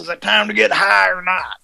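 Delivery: high, loud pushy stoner. Pretend. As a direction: demand it like an antsy hippie. Impatient stoner.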